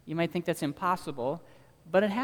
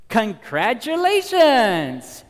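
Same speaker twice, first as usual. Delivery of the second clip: booming, slow, very loud game-show announcer; hammed-up prize announcer, drawn out; big announcer voice; 9 semitones higher, 15 dB louder, much slower than usual. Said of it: "some big announcer voiceover voice says to the baby".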